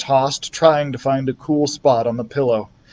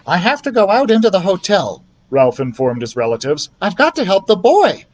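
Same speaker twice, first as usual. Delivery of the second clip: high, louder little mouse voice; mouse Ralph, determined; 6 semitones higher, 4 dB louder than usual. A narrator's reading. Ralph telling his relatives he must go, determined mouse voice. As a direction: announce it bravely like a tiny hero.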